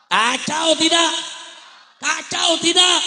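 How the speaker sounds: slow, high, loud call to the crowd; loud drawn-out chant-like question; rallying shout. Real, not acted. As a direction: rouse the crowd for an answer. Calls on crowd to respond.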